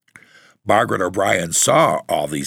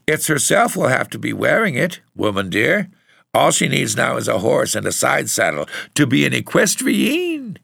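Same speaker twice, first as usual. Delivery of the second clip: high-pitched, amused teasing; bursting with laughter; 8 semitones higher than usual. A narrator's reading. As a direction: tease as if barely holding back laughter. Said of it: "bursting into irrepressible laughter".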